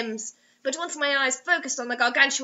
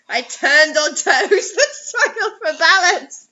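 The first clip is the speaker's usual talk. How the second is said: louder, laughing through the words; breaking into loud laughter; laughing fit; 4 semitones higher, 7 dB louder than usual. Real, not acted. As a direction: lose it mid-sentence. Reading collapses into laughter.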